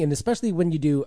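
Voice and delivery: ordinary talk, nothing marked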